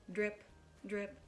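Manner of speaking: usual speaking voice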